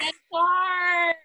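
name yelled high and loud; excited shout. Real, not acted. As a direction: cheer the name out. Echoing the guest's name.

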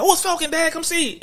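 put-on high, excited little kid spotting a hero; excited child voice. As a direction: squeal it like a thrilled small boy. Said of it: "my son doing that".

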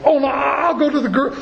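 high, quick protest with a laugh; Peter insisting. Retold or imitated. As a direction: brush off the warning eagerly.

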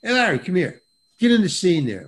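lightly imitated director giving a quick order; casual director calling out. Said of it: "Nobody talks to you like that".

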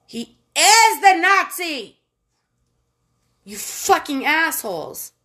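loud, high, slow exasperated outburst; outraged retort. Real, not acted. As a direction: hammer it home in outrage. Furious retort to propaganda.

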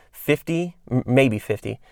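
ordinary talk, nothing marked

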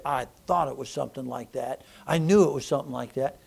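soft, knowing murmur; quiet knowing mom. Retold or imitated. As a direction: say it softly, as if she suspected it. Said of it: "And she said".

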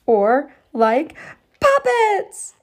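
very high loud exclamation; exclaimed. Acted. Emerson exclaiming puppets to the girls, excited. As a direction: blurt out the idea. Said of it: "exclaimed Emerson".

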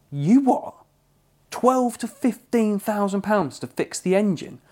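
stunned, high-pitched outrage; shocked disbelief. Retold or imitated. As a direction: let the voice jump up in disbelief. Retold shock at the repair quote.

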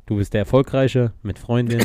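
usual speaking voice

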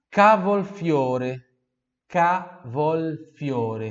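cavolfiore stretched slowly, loud, syllable by syllable; slow loud model. Shown as a demonstration. Teacher models cavolfiore slowly so students repeat. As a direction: draw out cavolfiore for learners to copy.

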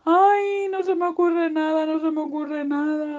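high drawn wail, fading from loud; hammed-up helpless lament; sad piglet. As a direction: wail it in mock despair. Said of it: "tan triste".

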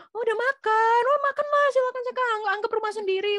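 very high, loud, gushing welcome; effusively friendly host. Acted. Indonesian host urging a guest to eat, effusively friendly. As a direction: fuss over a guest in a sing-out, hammed-up voice. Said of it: "Itu tuh ramah gitu kan".

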